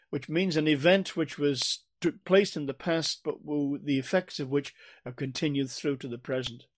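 usual speaking voice

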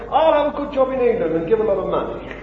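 quick, breezy put-on voice, fast; mock smug quote. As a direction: rattle it off like a comfortable excuse. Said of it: "He didn't say".

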